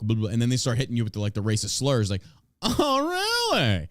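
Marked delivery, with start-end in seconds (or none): 2.6-3.9 mocking high reply. Mocking reaction to racist slurs.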